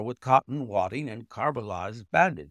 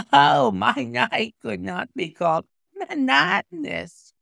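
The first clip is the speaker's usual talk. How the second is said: much higher and louder, laughing through it; 11 semitones higher, 7 dB louder than usual. A narrator's reading. Injured visitor laughing off his monotonous night. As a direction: burst out half laughing. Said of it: "said he, and laughed".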